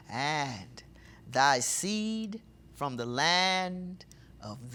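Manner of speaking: slow, weighty reading of the verses, loud; solemn scripture reading. Acted. Preacher reads the verse to his flock, slow and solemn. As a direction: proclaim the prophecy slowly to the congregation.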